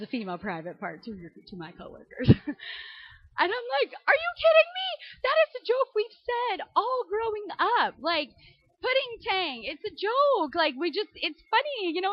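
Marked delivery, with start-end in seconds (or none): 4.1-12.1 high, loud incredulous protest; outraged it's just a joke; indignant disbelief. Retold or imitated. Wife protesting to husband, high incredulous voice.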